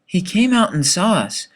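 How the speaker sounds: ordinary delivery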